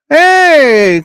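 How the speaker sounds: loud, high hey; cheerful shout; calling out. Real, not acted. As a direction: call out to a friend. Calling out to viewer.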